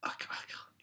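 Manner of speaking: defeated aside in a whisper; breathy resigned whisper. Real, not acted. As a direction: whisper it, giving up. Gives up on imitating.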